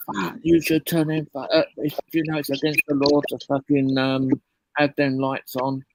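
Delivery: stern traffic cop. Retold or imitated. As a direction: sneer the cop's lecture back at him.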